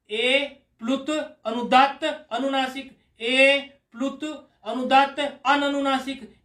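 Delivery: slow, even chant with every line starting on ए; measured recitation. Shown as a demonstration. Teacher demonstrating a steady recitation pattern to the student, slow and even. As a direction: recite each variety in one fixed pattern, unhurried. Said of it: "तो प्लुत में भी पहले ही ए ले लिया".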